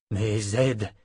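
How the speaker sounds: plain reading voice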